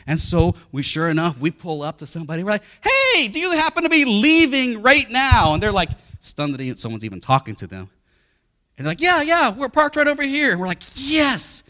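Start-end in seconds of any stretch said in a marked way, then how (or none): 2.8-5.5 high, loud, drawn-out hail; calling out. Retold or imitated. Calls to stranger in parking lot. 9.0-10.5 high, loud acted reply; calling back. Strangers call back, acted. 10.9-11.6 high, loud exultant yes; triumphant cheer. Retold or imitated. Couple finds a parking spot.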